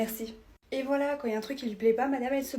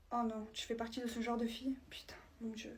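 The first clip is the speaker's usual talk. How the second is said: whispered aside; 12 dB quieter than usual. Acted. To her friend about the new girl, a whispered aside. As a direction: hiss it to your friend so she can't hear.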